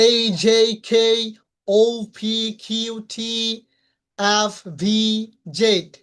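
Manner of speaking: letter names stretched out clearly; deliberate demo of difficult letter sounds; careful letter pronunciation. Shown as a demonstration. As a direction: recite the letters distinctly for learners. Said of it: "দশটি বর্ণ উচ্চারণ করতে".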